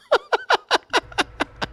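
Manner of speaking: squealing high laugh. Real, not acted. Laughs after mocking gamer.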